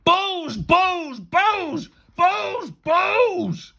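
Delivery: frantic high shout, word by word; outraged teacher shouting in a frenzy. A narrator's reading. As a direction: holler it over and over, loud and high. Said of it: "he kept shouting over and over again".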